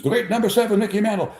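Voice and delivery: high, rising introduction of a star; stadium announcer. Retold or imitated. Crystal introducing Mantle at Yankee Stadium, announcer style. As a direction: mimic an emcee building up to the name. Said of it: "he introduces him".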